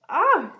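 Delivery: a high, loud squawk of surprise; startled squawk. A narrator's reading. Grandma scratched in bed, a high loud squawk. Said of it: "she squawked".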